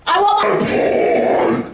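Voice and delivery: high, slow, crying shout for pie; hungry giant's roar. Acted. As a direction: bellow it like a greedy monster. Bertha chasing the pig.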